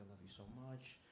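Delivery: low, soft imitation of his girlfriend; imitated whispering. Girlfriend whispering lovingly in his ear during a hug, soft imitated whisper. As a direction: murmur it softly, mimicking her whisper. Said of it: "She's whispering in my ear".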